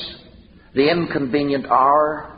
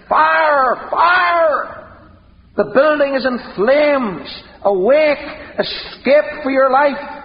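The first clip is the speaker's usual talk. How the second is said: high shouted warning down the corridor; shouting fire alarm; 8 semitones higher, 3 dB louder, slower than usual. Retold or imitated. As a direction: yell fire to wake the sleepers. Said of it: "someone runs down the corridor shouting".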